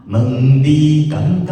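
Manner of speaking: loud, slow, weighty 敢 in 问你敢不敢; full-bodied 敢. Shown as a demonstration. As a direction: sing 敢 thick like 含. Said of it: "那个重量就会，厚度就会有". (敢唱得像含一样有厚度)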